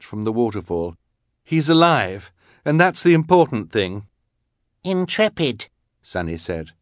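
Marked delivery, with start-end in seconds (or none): 1.4-4.1 insistent. A narrator's reading. Klaus insisting to Violet. 4.8-5.7 put-on baby voice. A narrator's reading. Baby Sunny's voice.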